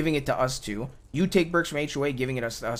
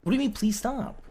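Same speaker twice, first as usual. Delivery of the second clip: higher-pitched, affronted pleading; exasperated protest; 5 semitones higher than usual. Real, not acted. Asking Andy for a chance to talk, exasperated protest. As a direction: protest being told to stop.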